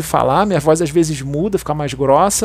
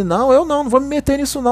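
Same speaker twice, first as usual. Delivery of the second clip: higher-pitched imitated protest; retold refusal; 7 semitones higher, faster than usual. Colleague refusing to pass on the warning, mock protest. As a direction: back away from it, pitched up.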